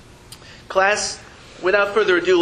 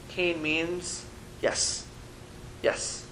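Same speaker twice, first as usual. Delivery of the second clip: 1 slow and soft; 12 dB quieter, much slower than usual. Shown as a demonstration. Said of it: "this is a very important word".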